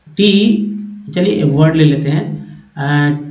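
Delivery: usual speaking voice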